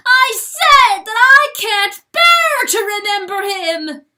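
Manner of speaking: shriek. A narrator's reading. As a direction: shriek in anguish. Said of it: "he shrieks".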